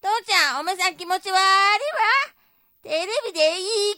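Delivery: high-pitched, needling dialect wife; nagging wife in a skit. Acted. Wife scolds husband for grinning at TV, nagging. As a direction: pick on your husband in a big stage voice. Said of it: "でけえ声出して".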